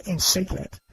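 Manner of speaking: ordinary talk, nothing marked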